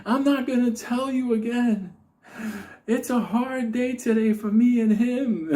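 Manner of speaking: high, slow, strained threat; mock-menacing high voice; the Unabomber warning. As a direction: warn them one last time, high and tight. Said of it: "he tells him".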